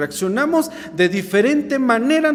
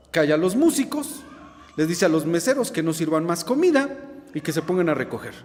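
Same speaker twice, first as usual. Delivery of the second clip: normal talking voice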